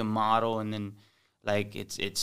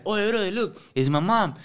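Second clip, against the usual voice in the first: high, loud excited fan voice; eager fan; 9 semitones higher, 6 dB louder than usual. Retold or imitated. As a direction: gush like a starstruck fan.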